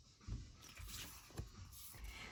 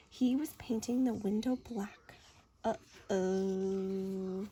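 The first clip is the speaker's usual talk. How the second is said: hushed, secretive; suspense whisper; 6 semitones lower, 6 dB quieter, much slower than usual. A narrator's reading. Narrator building suspense for the child, whispering. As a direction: whisper the reveal.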